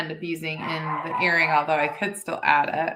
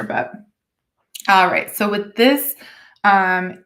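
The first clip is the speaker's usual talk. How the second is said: ordinary talk, nothing marked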